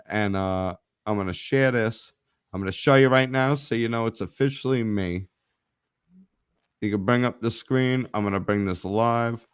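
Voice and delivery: ordinary talk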